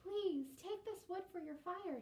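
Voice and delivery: high, earnest offer; generous girl. Acted. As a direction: give it gladly to the old woman. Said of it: "she said".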